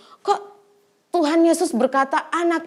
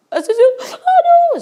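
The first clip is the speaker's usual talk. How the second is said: mock panic; 8 dB louder than usual. Imitating panicked crying.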